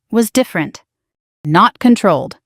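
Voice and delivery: plain reading voice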